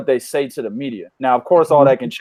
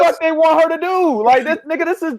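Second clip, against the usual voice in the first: excited; 12 semitones higher than usual. Real, not acted. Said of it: "excited outburst".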